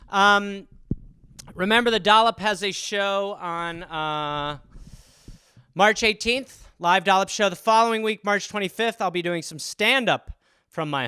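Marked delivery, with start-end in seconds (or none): none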